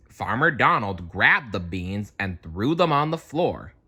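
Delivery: ordinary delivery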